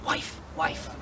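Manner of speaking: hushed conspiratorial whisper. Acted. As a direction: whisper it so no one hears. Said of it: "they whispered in hushed voices".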